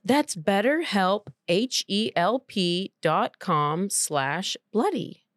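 slow letter by letter ad read; slow spelled-out promo code. Acted. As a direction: spell each letter slowly.

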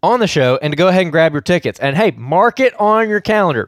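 loud, upbeat radio promo; ad read. Acted. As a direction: pitch the event like an announcer.

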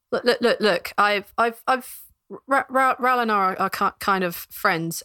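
halting, stuttered plea; hesitant guard tripping over words; stammering guard. Acted. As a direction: stumble through an awkward explanation.

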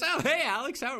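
the cousin suddenly recognising him; surprised recognition. Retold or imitated.

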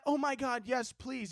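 soft, high pretend gratitude; sarcastically sweet and hushed; mock politeness. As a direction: coo it like an overly courteous driver. Mocks polite driver, soft and high.

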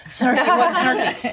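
mock panic at a review; alarmed disbelief. Retelling her friend's news, loud startled laughing outburst.

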